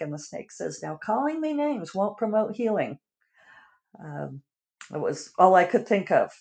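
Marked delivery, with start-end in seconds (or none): 0.8-3.0 mock protest. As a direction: play the snake piously objecting. Snake's hypocritical complaint in fable.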